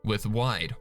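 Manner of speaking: usual speaking voice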